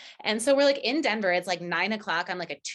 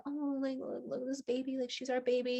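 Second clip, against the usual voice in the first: higher, softer doting coo; cooing baby talk; 6 semitones higher, 8 dB quieter than usual. Retold or imitated. Holding the sleepy puppy, soft high cooing. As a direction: melt over the puppy.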